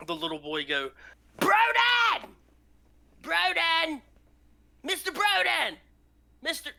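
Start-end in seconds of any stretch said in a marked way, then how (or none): 1.4-6.7 put-on high, loud child's call; a kid shouting a name. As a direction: yell for the neighbour through the door. Boy calls at the door.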